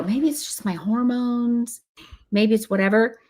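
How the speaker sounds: half-acted unsure young woman. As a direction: play her puzzled, pitch lifted. Women voiced wondering.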